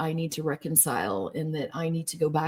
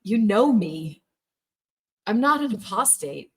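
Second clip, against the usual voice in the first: louder, higher insistence, pleading hard; retold outburst; 4 semitones higher, 7 dB louder than usual. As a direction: push back, voice rising in protest. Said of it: "I'm like".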